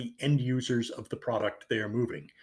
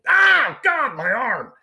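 high, loud mock cry of pain; sudden hammed-up agonized shout; pained yell; 11 semitones higher, 8 dB louder, much slower than usual. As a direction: yelp as if the cat just clawed you. Acting out scratched cat petter.

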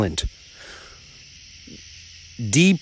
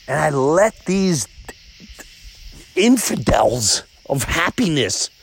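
ordinary delivery

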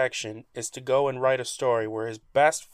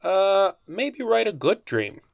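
tentative suggestion; 6 semitones higher than usual. Pretend. Pitches timid idea to co-host.

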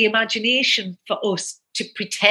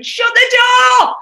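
high, loud re-enacted shout; screaming; 14 semitones higher, 9 dB louder than usual. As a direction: scream it out like an exasperated order. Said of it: "I used to be screaming".

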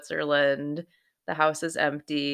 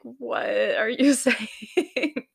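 higher, slow, laughing confusion; confused laughing; 7 semitones higher, much slower than usual. Retold or imitated. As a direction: laugh through the words, lost in a foreign call. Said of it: "I'm just like".

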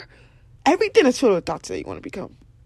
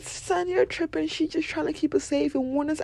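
friend scolding; 7 semitones higher, 4 dB quieter than usual. Retold or imitated. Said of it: "My other friend said".

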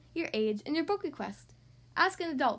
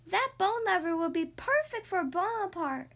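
excited mummy voice; 6 semitones higher than usual. Acted. Mummy spots dog.

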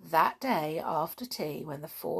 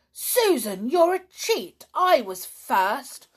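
much higher, louder furious outburst, slowing on "I was first"; in a rage; 8 semitones higher, 8 dB louder than usual. Acted. Donald raging at Susan for taking his cards. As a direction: accuse her hotly. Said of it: "cried Donald in a rage".

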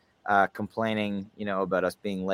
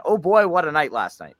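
high, loud burst of delight; excited; 5 semitones higher, 5 dB louder than usual. Real, not acted. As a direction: gush about last night's show. Host recalls great concert night.

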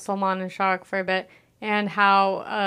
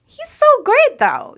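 squealing, gushing praise, much higher and louder; gushing fan; 8 dB louder than usual. Retold or imitated. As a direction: swoon over him like a starstruck fan. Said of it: "you're like".

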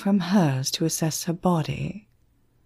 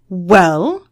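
demanding; 8 dB louder than usual. A narrator's reading. Emma demands an answer.

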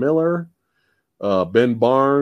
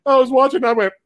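normal talking voice

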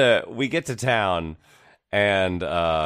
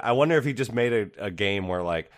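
ordinary talk, nothing marked

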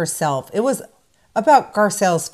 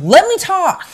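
mock angry demand; 10 semitones higher, 6 dB louder than usual. Host imitating Kyle snapping at Sutton, high loud mock demand. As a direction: demand the floor in a shrill mock. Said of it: "She's like".

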